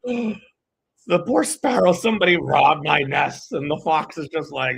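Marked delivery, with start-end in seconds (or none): 0.0-3.5 much higher, slow hammed-up lament; wailing sparrow. Sparrow crying to animal Twitter, much higher slow mock wail. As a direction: wail like a robbed little bird.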